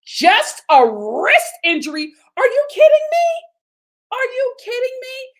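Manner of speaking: high, loud incredulous shriek; outraged disbelief. Real, not acted. Vlogger outraged at husband's dismissal, loud shrieking disbelief.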